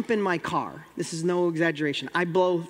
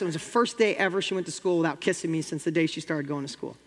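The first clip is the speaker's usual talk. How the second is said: normal talking voice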